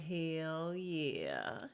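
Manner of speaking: soft, low, drawn-out approval; mock hushed hell yeah. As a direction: breathe it out low like a quiet cheer. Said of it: "And I'm like".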